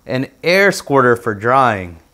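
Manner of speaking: plain reading voice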